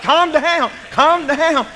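high, loud retold shout; urgent calling out. Preacher calling to coworker thrashing in attic, urgent. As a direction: shout each plea to a panicked friend.

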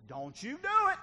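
loud, high shouted command; acted divine warning. God repeating a warning to the defiant, loud shout. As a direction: raise it to a sharp, high shout. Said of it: "When God says".

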